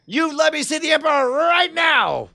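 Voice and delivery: high, strained hostage-taker threat; threatening yell. Acted. Player demands to see the Emperor, threatening yell. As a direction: yell it as an ultimatum. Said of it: "I say, I swear to God".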